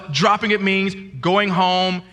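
normal talking voice

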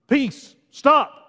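high, loud words of command; commanding shout. Acted. Preacher as Jesus stilling the storm, commanding. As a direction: order the storm to halt.

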